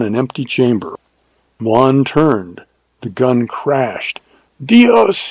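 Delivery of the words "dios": high, drawn cry, short of a full scream; strangled death cry. A narrator's reading. Shot bandit crying out as he dies, strangled scream. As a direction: cry out high as if struck dead. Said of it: "he screamed in a strangled death cry".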